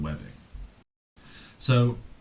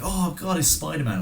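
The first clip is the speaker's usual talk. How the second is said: higher-pitched mock-amazed bystanders; mock excited crowd; 10 semitones higher than usual. Retelling the bullies spotting Spider-Man, mock excited. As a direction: put on a high, wowed onlooker voice.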